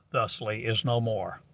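ordinary delivery

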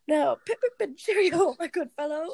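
a light hammed-up posh English accent. [name] doing his English guy voice for friends, light English accent. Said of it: "he does the English voice".

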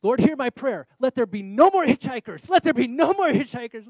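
hammed-up shouted plea to heaven, high and very loud; desperate prayer. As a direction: cry out a mock-desperate prayer. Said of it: "I'm all of a sudden praying".

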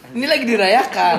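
loud, high party cheer; rowdy party animal. Real, not acted. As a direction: whoop it up over drinks. Drinking on the podcast.

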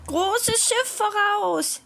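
high, slow lookout call; monkey calling out. A narrator's reading. Monkey spotting a big ship, calling out. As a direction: call it from the masthead, high and sustained. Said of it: "Endlich rief der Affe".

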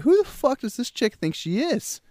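high-pitched indignant mock outrage; indignant retort. Guy retelling being kicked out by some chick, indignant.

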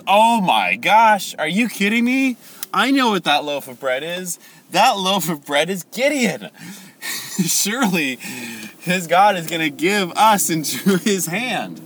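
high, loud mock astonishment; astonished soldier. Soldier interpreting his comrade's dream, hammed-up amazement. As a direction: overplay the amazed realization, drawing out the first words.

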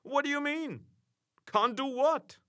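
high, sharp indignant query; indignant surprise. A narrator's reading. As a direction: demand it, astonished. Narrator indignant questions.

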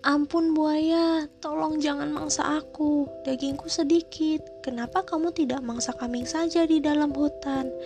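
frightened pleading duck. A narrator's reading. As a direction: plead for your life, voice trembling high. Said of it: "ucapnya seraya menangis ketakutan".

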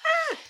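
sudden high startled shriek; mock scream. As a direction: yelp as if spooked. Mock fright at Candyman.

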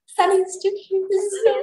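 high-pitched melting coo; real gushing. Real, not acted. Reader reacts to sweet Sunny, gushing. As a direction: coo over something adorable. Reader gushes over cute character.

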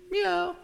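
high, softish imitated meow; cat meow. Cat sits up after the prayer, imitated meow.

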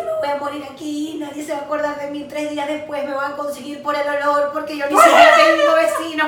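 rising, building comic despair; hammed-up doom spiral; mock panic. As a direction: start worried and build to a loud laughing wail. Comic re-enactment of panicked thoughts.

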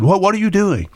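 mock alarm at the wife's scissors; alarmed husband. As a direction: catch her in the act, alarmed. Husband catching wife cutting shirt.

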